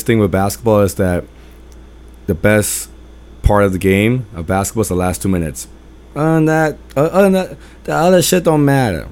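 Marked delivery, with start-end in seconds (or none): 6.2-9.1 higher, louder imitation of pops' accented English. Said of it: "as my pops would say with his, uh, English accent".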